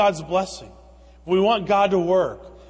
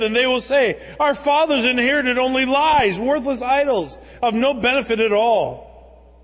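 louder, higher proclaiming read; preaching scripture; 4 semitones higher, 4 dB louder than usual. Acted. Preacher proclaiming the nations' confession to the congregation, loud and fervent. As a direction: build the verse to a ringing declaration.

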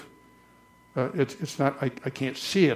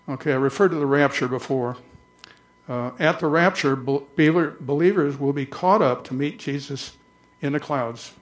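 ordinary delivery

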